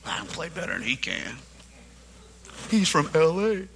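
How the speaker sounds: muttered soft brag; quiet boastful aside. Retold or imitated. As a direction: say it under your breath, smug.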